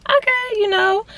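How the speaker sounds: high, put-upon okay; exasperated okay. Retold or imitated. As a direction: sigh it out high and fed up. Agreeing to fetch the cat.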